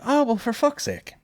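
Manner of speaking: high, slow mock despair; hammed-up exasperation; exasperated. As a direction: throw your hands up. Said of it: "And I was like".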